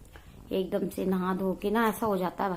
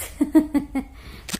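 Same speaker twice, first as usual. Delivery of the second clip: short loud, higher-pitched laugh; real laugh; 6 semitones higher, 6 dB louder than usual. Real, not acted. Vlogger joking about winning the child over, laughing. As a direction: laugh out loud at your own remark. Laughs at her plan for son.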